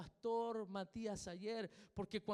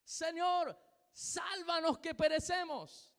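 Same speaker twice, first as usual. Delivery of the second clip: high, loud cry for rescue; desperate shout; 8 semitones higher, 6 dB louder, slower than usual. Shown as a demonstration. Pastor acting the disciples crying out in the storm, shouting. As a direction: shout it like men about to drown. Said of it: "Signo de exclamación es un grito".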